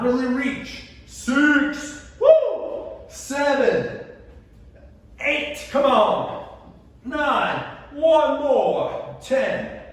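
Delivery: high, loud rep counting; calling out over the strain; effortful coaching shout. Real, not acted. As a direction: push them through the last reps, loudly. Counting reps with rising effort.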